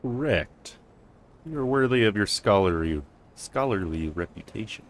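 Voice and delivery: usual speaking voice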